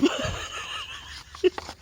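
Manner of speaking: very high breathy giggle. Real, not acted. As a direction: burst into a squeaky laugh. Laughing at her own joke.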